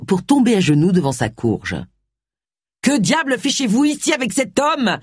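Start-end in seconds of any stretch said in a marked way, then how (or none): 2.8-5.0 high, indignant outburst without much volume; restrained furious yell. A narrator's reading. Wilkes catching Agatha with the suspect, indignant mock yell. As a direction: exclaim high in contained outrage. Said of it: "hurla-t-il".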